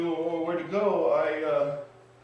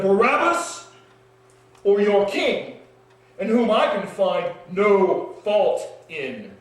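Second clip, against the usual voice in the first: high, loud proclamation, slowing to a crawl at the end; Pilate yelling; 5 semitones higher, 11 dB louder, slower than usual. Acted. Pilate offers the crowd a prisoner, loud ringing shout. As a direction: bellow the choice to the crowd. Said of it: "yell something about prisoners and kings".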